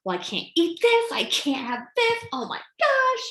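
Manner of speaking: high, rising fretting; hammed-up distress, climbing in pitch; mock panic. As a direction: panic dramatically over what's off limits. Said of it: "I'd be panicking".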